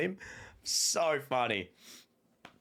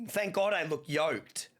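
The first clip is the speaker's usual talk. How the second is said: usual speaking voice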